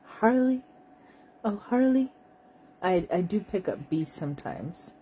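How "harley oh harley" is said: high, loud cooing to a dog; pet-talk voice, pitched up and louder; doting dog owner. Retold or imitated. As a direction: call the dog's name like fussing over a baby. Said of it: "I'd be like".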